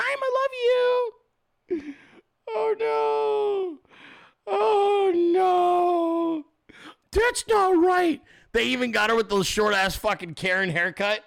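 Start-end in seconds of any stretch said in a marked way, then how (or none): 0.0-1.9 high, far-off gushing; swooning mock voice. Mocking the ad couple in a squeaky gush. 2.5-8.2 fake-sob it like a melodrama; mock crying. Streamer mock-wailing at the headline, hammed-up cry.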